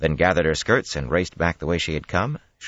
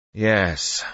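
a slow, drawn-out yes; said slowly; 4 semitones higher than usual. A narrator's reading. Rand reluctantly answers the men, speaking slowly. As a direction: stretch the single word out. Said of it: "Rand said slowly".

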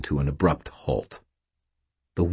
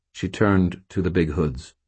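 usual speaking voice

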